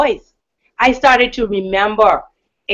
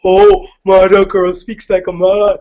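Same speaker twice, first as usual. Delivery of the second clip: mocking young peer. Peer remarking on how a girl speaks, mocking imitation. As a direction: imitate a guy teasing about her voice. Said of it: "when he said".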